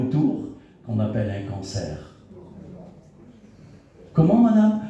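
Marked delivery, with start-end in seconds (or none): 4.1-4.8 high, loud shocked question. Retold or imitated. Son stunned by the cancer diagnosis, high and loud. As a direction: blurt it out in shock.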